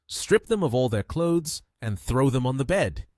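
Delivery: ordinary delivery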